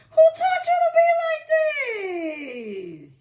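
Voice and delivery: very high, loud laughing squeal; mock-appalled shriek through laughter; squealing disbelief. Real, not acted. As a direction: wail it while cracking up. Teasing querent while cracking up.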